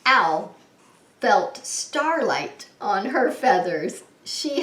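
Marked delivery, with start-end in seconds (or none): none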